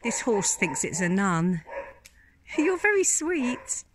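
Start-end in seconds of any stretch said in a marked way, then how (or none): 2.6-3.8 talking to an animal. Real, not acted. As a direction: coo it to the horse. Talking sweetly to a horse.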